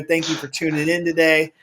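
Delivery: normal talking voice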